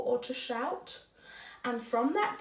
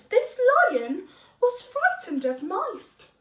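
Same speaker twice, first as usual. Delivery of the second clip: plain reading voice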